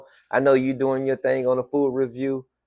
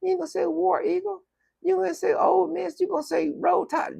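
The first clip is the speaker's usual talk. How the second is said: incredulous; 7 dB quieter than usual. Retold or imitated. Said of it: "I'm like".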